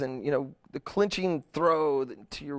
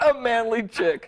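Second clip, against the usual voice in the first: laughing; 6 semitones higher than usual. Real, not acted. Laughing repeat of quote.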